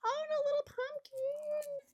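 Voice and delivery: very high, sing-song coo; high-pitched cooing. Real, not acted. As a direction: squeal it way up high, delighted by something cute. Cooing over a cute find.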